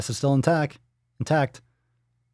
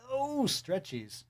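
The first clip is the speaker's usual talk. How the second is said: soft, high, slow coo; cooing pet talk; 6 semitones higher, 12 dB quieter, slower than usual. Real, not acted. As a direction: coo it gently to a stretching dog. Cooing at the stretching dog.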